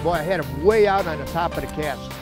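ordinary talk, nothing marked

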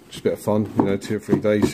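usual speaking voice

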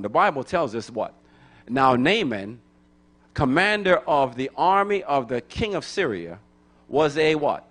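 ordinary delivery

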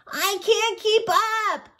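high, drawn-out cry of a struggling badger; desperate cry. A narrator's reading. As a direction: cry out slowly and very high, falling behind. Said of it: "cried Little Badger".

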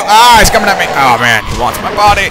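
high, loud play-panic; hammed-up victim's yelp; mock panic. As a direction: cry out in pretend alarm. Mock panic as zombie attacks.

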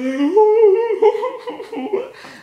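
shrieking high loud laugh. Real, not acted. Vlogger laughing at his own yawning rant, high loud laugh. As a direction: cackle at yourself, high and loud.

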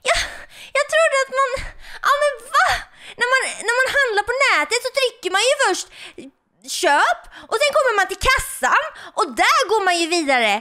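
shocked disbelief, talking fast; real panicked shock. Real, not acted. Accidentally bought the skin. (chockad panik när köpet gick igenom)

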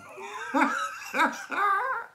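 real laugh rising very high; high laugh fit. Real, not acted. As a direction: crack up again, high.

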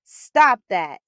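loud, commanding stop; firm shout. Acted. Daryl standing up to the kids picking on a smart kid, firm loud order. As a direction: plant your feet and order them to quit.

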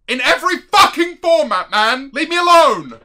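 high, loud plea to be left alone; exasperated shout. Real, not acted. Streamer begging chat to leave him alone, shouting. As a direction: yell it like chat is hounding you. Mock-despair outburst at chat.

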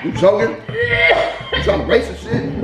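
laughing. Real, not acted. Laughing at friend choking.

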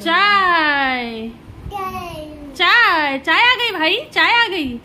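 loud drawn-out tea call. Real, not acted. Announcing to the household that tea has come, calling out loudly. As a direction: holler it out, stretching the word चाय.

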